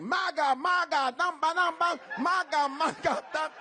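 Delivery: high, loud mock Spanish accent chant. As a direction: ham up a fake Spanish accent. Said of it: "I can almost sound Spanish".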